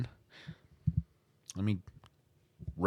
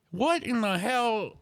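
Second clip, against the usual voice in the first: exasperated disbelief; 11 semitones higher than usual. Real, not acted. Exasperated rant reaction.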